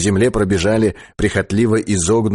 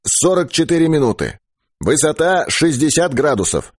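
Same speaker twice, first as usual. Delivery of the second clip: high, raised loudspeaker report voice; radio operator reporting; 6 semitones higher than usual. Acted. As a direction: relay coordinates over the radio, pitched up. (высокий голос радиста, докладывающего по репродуктору)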